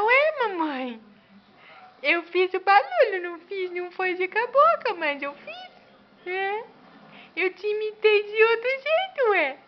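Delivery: put-on high, loud baby voice. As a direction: answer cheekily as the baby. (voz de bebê aguda)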